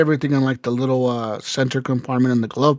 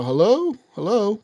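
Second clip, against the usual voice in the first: higher-pitched, questioning call; imitated concerned operator; 6 semitones higher than usual. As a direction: check if someone on the line can hear you. Imitating operator checking on crash victim.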